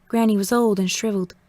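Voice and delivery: usual speaking voice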